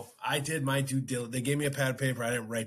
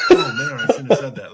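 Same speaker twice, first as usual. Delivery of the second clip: laughing; 15 dB louder than usual. Real, not acted. Laughing embarrassed retelling.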